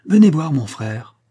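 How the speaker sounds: loud, slow eager call; excited cry. Acted. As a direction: announce the brother with excitement. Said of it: "s'écria Hortense".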